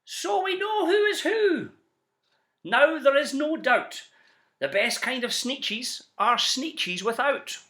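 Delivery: high, loud, slow crowing chant; triumphant shout. Acted. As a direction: shout it out proudly on parade. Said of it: "they let out a shout".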